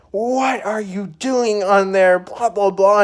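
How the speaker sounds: slow, high, loud mock coach yell. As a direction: drag out the scolding at the kid. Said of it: "this coach knew how to yell".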